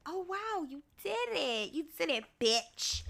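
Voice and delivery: high, soft mock-impressed voice; mock amazement. Speaker congratulating herself on getting through it, mock amazement. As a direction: coo it in pretend wonder. Voices own reaction to talking briefly.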